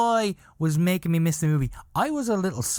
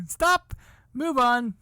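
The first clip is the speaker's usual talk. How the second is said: impatient viewer yelling; 11 semitones higher, slower than usual. Pretend. Viewer of his own video shouting stop, move on. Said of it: "if I was watching somebody else".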